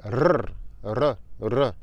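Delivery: r, r, r with the tongue tapping; rolled R. Shown as a demonstration. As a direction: tap the Spanish r with the tongue tip. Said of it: "Ce son se prononce avec la langue".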